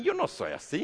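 high, whiny denial; a caught thief whining his innocence to the judge; mock denial. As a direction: whine it out.

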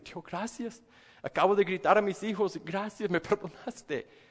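high, half-laughing gratitude; laughing thanks. Pretend. Thanking God after yelling. (gracias medio riendo)

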